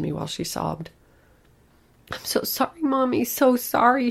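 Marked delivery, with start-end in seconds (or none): 2.1-4.1 put-on high wailing child's plea. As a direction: wail it like a sobbing little girl. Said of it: "she wailed".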